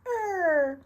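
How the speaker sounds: high, loud pageant queen voice; poised pageant answer. Shown as a demonstration. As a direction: sing it out like a beauty queen. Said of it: "this is the beauty queen voices".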